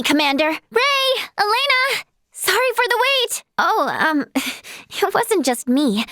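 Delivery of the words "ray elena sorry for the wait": high, lifted call to friends. Acted. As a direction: hail them from a distance. Calling out to waiting friends.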